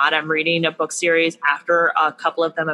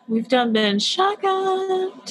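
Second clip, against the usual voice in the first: high, slow Southern drawl; mock Southern drawl; 12 semitones higher, much slower than usual. Podcaster joking with co-host about a shotgun wedding, mock Southern drawl. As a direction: stretch it out in a high-pitched Southern twang.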